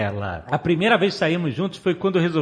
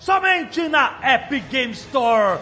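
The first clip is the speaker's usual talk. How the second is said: ad read; 4 semitones higher, slower than usual. Acted. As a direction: sell it like a radio spot. Reads a sponsor ad.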